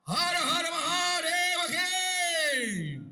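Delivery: high, full-voiced shouted invocation; devotional rallying cry. Real, not acted. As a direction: belt out the holy cry, dropping on the last word.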